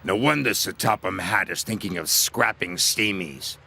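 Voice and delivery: taunting engine voice. Acted. Diesel taunts Thomas.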